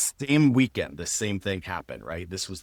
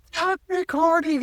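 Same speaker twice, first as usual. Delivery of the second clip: put-on much higher, louder little-kid protest; angry kid; 6 dB louder than usual. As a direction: squeal it like a furious four-year-old. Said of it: "being like".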